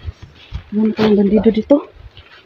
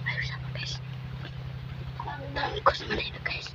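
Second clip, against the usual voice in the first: hushed whisper while hiding; 4 semitones higher, 15 dB quieter, slower than usual. Real, not acted. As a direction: whisper so nobody hears. Hiding after the chase.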